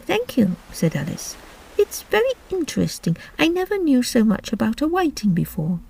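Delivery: put-on high, soft girl's voice; Alice voice. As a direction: speak like a polite little girl.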